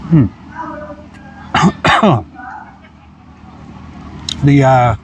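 chewing a big bite; talking through a mouthful; mouth full. Real, not acted. Seen 0: Bites burger at -3, cheeks full and chewing through the labelled words.

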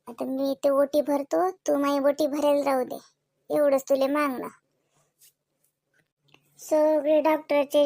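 murmured heartfelt prayer to the goddess; low devotional plea; quiet prayer. Acted. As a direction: pray softly, pleading for good reports. Prays aloud to goddess.

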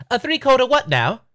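high, baffled British voice; British accent. Pretend. Nintendo questioning the arcade pitch, baffled British accent. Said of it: "Nintendo has a British accent".

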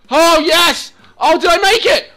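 high, loud disbelief and joy; excited, incredulous outburst; elated shout. Real, not acted. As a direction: yell with sudden hope. Thinks he made it.

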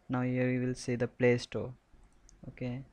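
ordinary talk, nothing marked

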